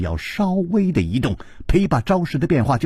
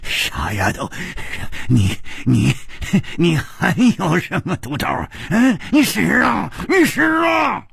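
hoarse taunt; 4 semitones higher than usual. A narrator's reading. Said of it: "哑着声音说". (哑着嗓子，越喊越高)

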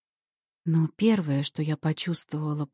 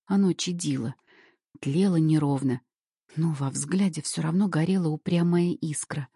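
ordinary delivery, nothing marked

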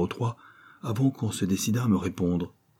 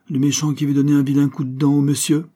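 gronderie câline, plus aiguë et forte; gronder un animal; 6 semitones higher, 5 dB louder than usual. A narrator's reading. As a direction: parle au furet comme à un enfant. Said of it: "s'adressant à un furet". (Sherman gronde tendrement son furet)

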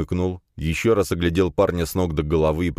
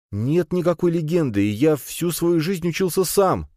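put-on much higher, strained young man's voice; anxious protest; 12 semitones higher than usual. A narrator's reading. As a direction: insist desperately, pitched way up. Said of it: "сглотнув ком". (взволнованно, на высоких нотах)

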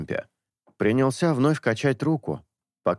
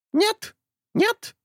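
high squawking bird. A narrator's reading. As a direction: parrot protesting. Bird denies. (птичий протест)

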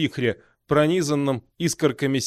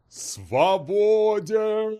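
slow, drawn-out thundering demon cry; thunderous demon roar; 6 semitones higher, much slower than usual. Acted. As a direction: boom it out like a freed demon. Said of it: "громовым голосом закричал Масрок".

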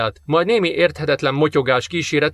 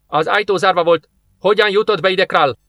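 higher, demanding question; számonkérő kérdés; 6 semitones higher, 3 dB louder than usual. A narrator's reading. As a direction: demand an explanation, pitch lifted. (számonkérően, emelt hangon)

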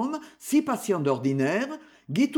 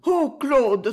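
high, urgent cry of entreaty; pleading; 6 semitones higher, 4 dB louder than usual. A narrator's reading. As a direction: implore your husband, voice lifting. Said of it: "supplia Perrine". (supplication, voix haut perchée)

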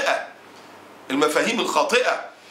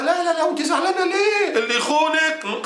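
high put-on woman's voice, slowing at the end; mocking friend; 9 semitones higher than usual. Friend telling the upset wife not to care, mocking voice. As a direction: play the flippant friend brushing it off. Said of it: "تقول لها".

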